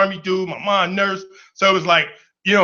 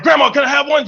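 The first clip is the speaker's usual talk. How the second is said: put-on higher, louder kid asking grandma; pleading kid; 7 semitones higher, 4 dB louder, faster than usual. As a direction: beg like a little boy. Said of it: "I'm like".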